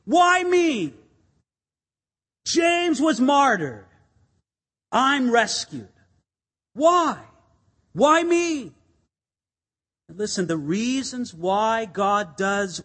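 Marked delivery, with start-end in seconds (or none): 0.0-8.7 a lightly voiced bewildered Peter; Peter asking why me. Peter asking why he was spared, lightly voiced wonder. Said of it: "It's almost he's asking".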